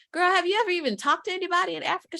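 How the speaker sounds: quick, high teasing disbelief; mock incredulous question to a friend. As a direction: fire it off fast and amazed. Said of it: "I was like".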